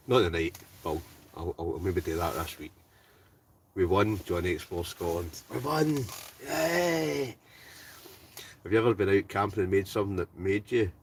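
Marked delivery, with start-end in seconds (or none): 5.5-7.3 high, bright burst of celebration; whooping yay, pitched right up; excited cheer. Real, not acted. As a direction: cheer the win. Celebrating a win on stream.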